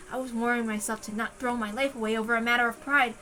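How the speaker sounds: ordinary delivery, nothing marked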